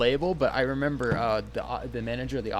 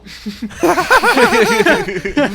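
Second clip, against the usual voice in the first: high, loud cackling laugh; loud burst of laughter; 12 dB louder than usual. Real, not acted. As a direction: howl with laughter. Cracking up at own story.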